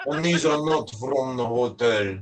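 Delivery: slow Russian-accented imitation. Wife bringing two coat hangers, imitated Russian accent. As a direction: imitate a Russian woman making her point. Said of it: "I'm from former Soviet Union".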